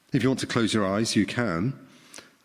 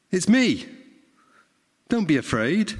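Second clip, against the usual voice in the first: Jesus reassuring; 7 semitones higher than usual. Acted. Jesus reassures frightened disciples.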